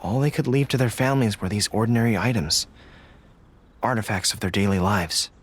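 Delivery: low, somber remembrance. Acted. General tells the traveler of her fallen soldiers, low and somber.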